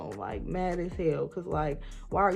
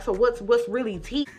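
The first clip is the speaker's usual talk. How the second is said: sassy callout; 5 semitones higher, 6 dB louder than usual. Retold or imitated. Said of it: "So I'm like".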